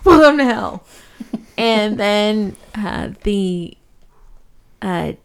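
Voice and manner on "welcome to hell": louder hammed-up demon, laughing into the welcome; mock demon refusal. Demon refusing the soul a juice box, loud laughing mock taunt. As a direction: deny it with gleeful mock cruelty.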